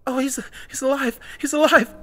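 high, stammering astonishment; stammered. A narrator's reading. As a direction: stammer it out in shocked relief. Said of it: "Heather stammered".

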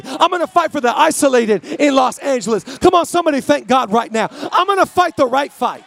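loud, building declarations with every line; preaching at full force. Acted.

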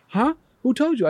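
high, puzzled acted question; startled mother. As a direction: question the child in surprise.

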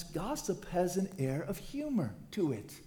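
normal talking voice

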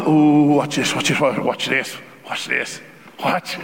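lightly voiced glee, waiting for them to fall; gloating anticipation.

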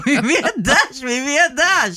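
giggling, insisting who sent regards; laughing question. Real, not acted. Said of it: "laughing while demanding the sender".

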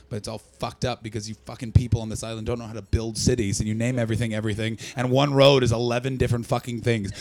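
ordinary talk

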